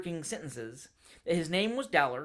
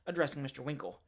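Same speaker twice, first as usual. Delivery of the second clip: ordinary delivery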